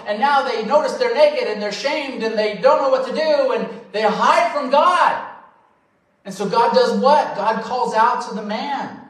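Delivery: animated preaching. Acted. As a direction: drive the story forward like a preacher. Preacher retelling Genesis with energy.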